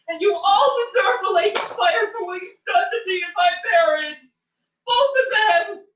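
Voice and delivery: shouting through tears; crying angry rant. Real, not acted. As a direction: rage at them while sobbing. Tearful furious rant at chat.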